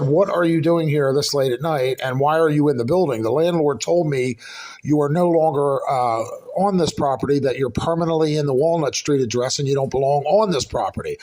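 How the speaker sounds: ordinary delivery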